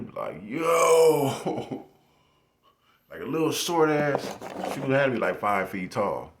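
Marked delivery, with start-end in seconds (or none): 0.4-1.8 high, loud startled yo. Retold or imitated. Student startled by the teacher, high surprised yo. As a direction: jump back startled.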